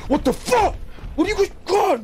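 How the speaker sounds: imitating a high, outraged yelp; mock furious. Retelling a gamer's rage over props, mock furious. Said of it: "he was just so fucking mad".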